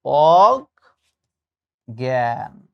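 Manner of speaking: অজ্ঞান very slow and low, "og ... gyan"; syllable-by-syllable pronunciation. Shown as a demonstration. Teacher showing the nasal mark, very slow অজ্ঞান as "og-gyan". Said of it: "প্রকৃত উচ্চারণ হচ্ছে এইটা".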